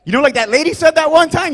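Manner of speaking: ordinary talk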